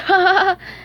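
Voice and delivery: high, loud gloating cackle; gleeful laugh. Acted. Plankton winning the formula, gleeful laugh. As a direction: laugh in triumph.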